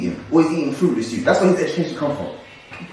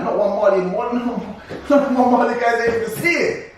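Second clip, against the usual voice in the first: cracking up mid-quote; laughing retort to friends; laughing reply; 8 semitones higher than usual. Retold or imitated. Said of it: "I'm like".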